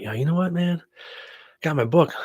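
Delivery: acted-out self-talk.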